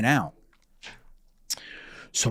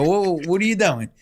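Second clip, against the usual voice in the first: startled question; 8 semitones higher, 4 dB louder than usual. Retold or imitated. Dad startled, questioning son.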